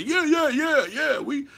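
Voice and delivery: high, loud hyped-up crowd cheer; mock rally cry. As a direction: ham up the whispering plotters' rally. Mocking a rallying group.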